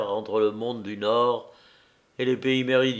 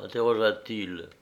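plain reading voice

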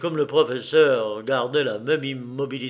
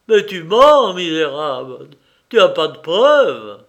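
put-on the professor roars at the boy, furious shout; explosive fury; 2 semitones higher, 10 dB louder than usual. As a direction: roar it, the voice exploding. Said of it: "une explosion de voix terrible".

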